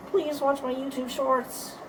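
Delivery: very high little put-on voice; sing-song high-pitched begging; put-on pleading. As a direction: squeak out a mock plea to viewers. Sudden put-on plea to viewers.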